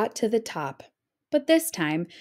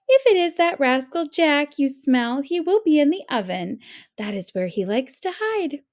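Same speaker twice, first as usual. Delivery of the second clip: giant's wife; 5 semitones higher, 3 dB louder than usual. A narrator's reading.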